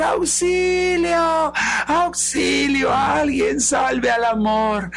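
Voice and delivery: very high, long drawn-out calls; theatrical cry for help. Acted. Desperate Love calling from the treetop, theatrical cry. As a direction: wail it out to be rescued. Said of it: "el amor empieza a gritar".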